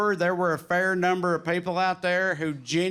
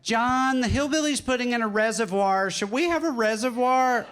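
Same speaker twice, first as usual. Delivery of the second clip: lightly voiced higher-pitched neighbor; mock a nosy neighbor calling to a spouse, pitched up; a hint of a fretful neighbor, higher voice; neighbor impression; 6 semitones higher than usual. Acting out worried neighbors watching him.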